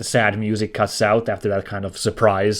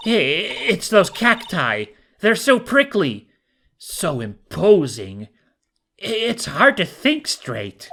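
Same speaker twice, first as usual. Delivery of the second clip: nervous, high voice; 8 semitones higher than usual. Acted. Character panicking about cacti.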